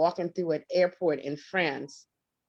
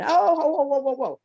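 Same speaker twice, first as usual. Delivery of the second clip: alarmed whoa; 6 semitones higher, 6 dB louder than usual. Real, not acted. Backing off from suicide topic.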